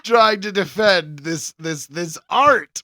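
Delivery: loud, high, laughing through the words; can barely get it out for laughing; real laughter, slow and loud; laughing protest. Real, not acted. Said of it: "you're just killing me".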